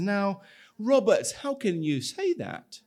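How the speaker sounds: protest, shocked and hurt; a touch of wounded outrage; upset and surprised. Acted. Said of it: "he's pretty upset and surprised".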